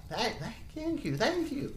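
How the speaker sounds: put-on high, trembling little girl. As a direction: play a frightened little girl. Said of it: "Is that your little girl voice?".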